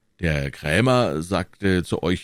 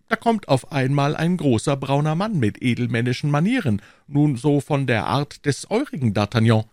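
raised-pitch character voice, recounting; Aramis; 8 semitones higher than usual. Acted. Aramis recounting a stranger's arrival, higher-pitched. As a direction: relate the encounter in a higher voice.

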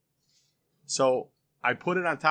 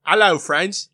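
loud, higher put-on British greeting; British accent; 5 semitones higher, 9 dB louder, slower than usual. Shown as a demonstration. [name] trying his British accent on request, loud British accent. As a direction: call it out in a mock British accent. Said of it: "Listen to [name]'s".

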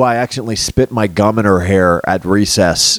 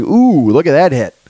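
mock sportscaster; 5 semitones higher than usual. Mock commentator gawking at a replayed hit. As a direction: play the gawking announcer. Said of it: "They're just showing replays".

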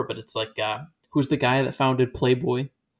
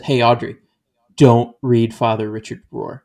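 mock stern warning; 7 dB louder than usual. Conservative friends warning Audrey, loud stern imitation.

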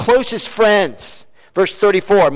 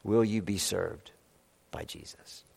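very soft, low, sinking to a whisper; hushed appeal; 9 semitones lower, 16 dB quieter than usual. Real, not acted. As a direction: put the question gently, almost inaudibly by the end. Closing appeal repeated to congregation.

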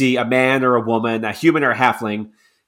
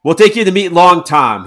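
louder, higher hearty invitation; 6 semitones higher, 7 dB louder than usual. Acted. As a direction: welcome them like recruits. Soldier cheerfully escorts newcomers.

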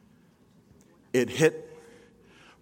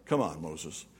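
soft chiding; 9 semitones lower, 8 dB quieter than usual. Real, not acted. Chiding Moses, fading.

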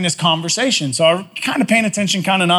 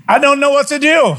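higher, louder, at a loss in the doorway; helpless panic; 6 semitones higher, 3 dB louder than usual. Retold or imitated. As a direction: blurt it out, stunned and helpless. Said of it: "I'm standing there now going".

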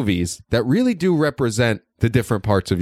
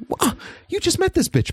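higher incredulous yelp; indignant mock outrage, pitched way up; mock disbelief. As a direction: squeak out the disbelief. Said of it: "and it's like".

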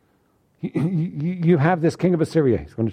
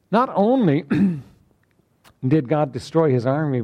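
ordinary talk

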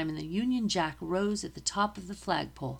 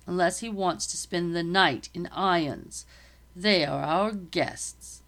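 commanding captain; 5 dB louder than usual. Acted. Captain instructing the sergeant, louder and commanding. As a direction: give orders with full authority. Captain keeps giving orders.

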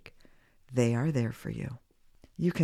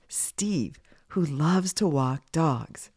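ordinary delivery

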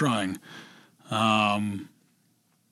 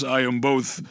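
normal talking voice

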